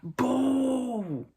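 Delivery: long, high crowd holler; drawn-out mock yell; imitated screaming. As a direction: bellow it like a fan all night. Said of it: "they were screaming it".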